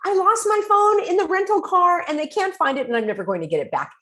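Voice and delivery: high, loud, relieved "yes, exactly"; relieved person. Retold or imitated. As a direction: voice someone finally feeling heard. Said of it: "that's when the person says".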